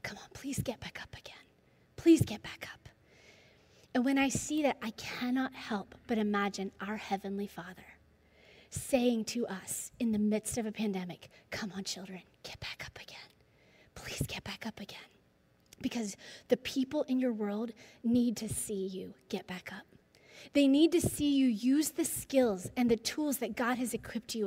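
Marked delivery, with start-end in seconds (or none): 0.0-2.9 urgent whispered pleading; hushed, begging whisper from afar; whispered plea. Retold or imitated. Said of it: "you hear his dad whisper these words". 11.5-19.8 soft pleading whisper; tender fatherly whisper; whispered encouragement. Retold or imitated. God voiced pleading like Miles' dad.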